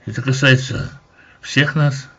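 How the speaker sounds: ordinary delivery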